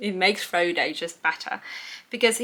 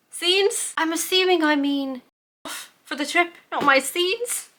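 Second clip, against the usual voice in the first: high, incredulous mock at her own note; amused disbelief; 10 semitones higher than usual. Real, not acted. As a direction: puzzle over your own typo, voice up high. Mocking her own typo.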